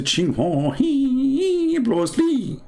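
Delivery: mock Chinese accent, high and drawn out; hammed-up fake Chinese, slow and high-pitched. Comic showing off his Chinese to viewers, mock Chinese accent. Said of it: "this is my Chinese, by the way".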